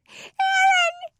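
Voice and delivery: put-on very high, loud child's shriek; piercing little-girl scream for help. A narrator's reading. Frightened little Sarah calling for her brother, screaming. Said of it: "The child's screaming went up an octave".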